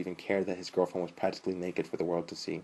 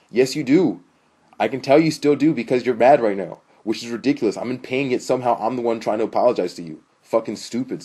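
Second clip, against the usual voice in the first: loud, higher, indignant arguing; fed up and raising the voice; heated bickering; 4 semitones higher, 10 dB louder than usual. A narrator's reading. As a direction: push back hard in a couple's quarrel. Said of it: "Sam and Haley bickering back and forth".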